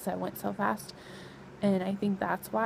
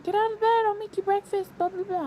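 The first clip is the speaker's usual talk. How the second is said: high, louder mocking imitation; mimicked nagging husband; 12 semitones higher, 3 dB louder than usual. Husband coaxing pregnant wife out of bed, mimicked in high mocking voice. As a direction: rattle it off high, making fun of his urging.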